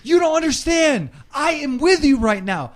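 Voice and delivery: high, loud mortified yelling; embarrassed furious shout. Retold or imitated. Imitating Blade ranting at friends in the Walmart line, loud mortified shout. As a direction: scream out public embarrassment. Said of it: "Blade loses it".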